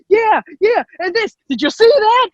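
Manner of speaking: high-pitched, carried-away outburst; over-excited. Retold or imitated. Speaker showing how they get carried away, over-excited. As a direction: imitate yourself getting far too into it. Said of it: "I get so into what I'm talking about, and my voice just".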